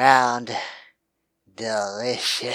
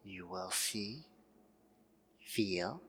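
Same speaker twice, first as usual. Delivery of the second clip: slow, soft hypnotist; hypnotic voice; 3 semitones lower, 11 dB quieter than usual. Acted. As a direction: lull them under, slowly. Hypnotist begins induction.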